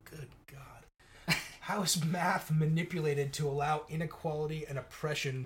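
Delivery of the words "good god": breathy laugh through the words. Real, not acted. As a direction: laugh it out in disbelief. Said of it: "couldn't even get through that without laughing".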